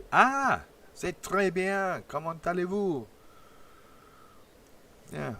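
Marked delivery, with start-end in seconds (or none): 0.1-3.0 high, slow hammed-up French accent; mock French greeting. Host greeting a Quebec viewer, hammed-up French accent. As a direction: put on a playful French host voice.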